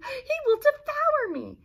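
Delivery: high, loud panicked cry; terrified Duke. Acted. Duke shrieks in terror at the dinochus, high and loud.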